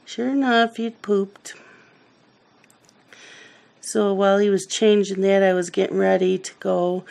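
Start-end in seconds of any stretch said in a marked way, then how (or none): none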